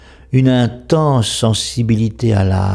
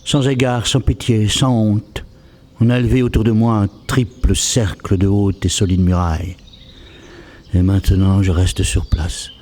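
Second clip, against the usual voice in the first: poem recitation; 2 semitones lower than usual. Acted. A walled-in man laments being shut from the world, poem recitation. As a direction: recite it line by line, letting silences fall between phrases.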